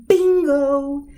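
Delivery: high, loud shouted bingo; shouting bingo. Shown as a demonstration. Winner shouting bingo at the game. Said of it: "you have to shout".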